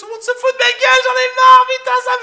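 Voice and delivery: very high, loud, fast whiny outburst; girly tearful rant. Retold or imitated. Commander complaining to the doctor, squealing girlish rant. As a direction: burst into a squealing wail. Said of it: "il pleure, quoi".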